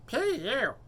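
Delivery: high mermaid voice; a mermaid pinching her nose. Acted.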